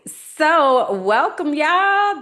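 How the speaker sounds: loud, high, slow welcome call; big show welcome. Real, not acted. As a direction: throw the welcome out to the room.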